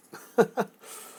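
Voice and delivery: quick high unvoiced chuckle; little laugh at yourself; short breathy laugh. Real, not acted. Laughs at the long term.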